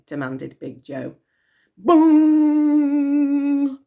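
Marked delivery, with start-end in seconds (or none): 1.8-3.8 voiced bong like a big clock bell. A narrator's reading. Narrator voicing Big Ben striking, bell bong.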